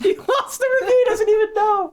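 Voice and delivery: high-pitched words squeezed through real laughter; laughing almost to tears; helpless laughter. Real, not acted. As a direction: crack up while talking, voice shooting up. Cracking up at host losing review.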